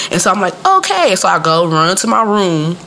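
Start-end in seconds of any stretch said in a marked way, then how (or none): 0.7-1.2 put-on high-pitched little-girl okay; obedient child. Child agreeing to fetch socks for her mother, obedient.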